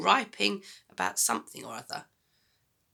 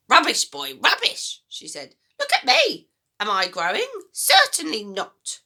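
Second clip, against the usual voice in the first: put-on high, loud, bossy old woman; a mean grandma giving orders, high and loud; grumpy grandma voice; 6 semitones higher, 9 dB louder than usual. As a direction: nag the boy like a sour old granny. Grandma scoffs.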